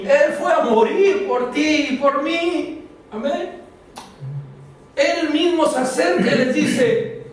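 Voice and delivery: high, slow, ringing preacher's call; rising pulpit fervor, drawn out; fervent preaching. Acted. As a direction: lift it up and drive it home to the congregation. Preaching at full force.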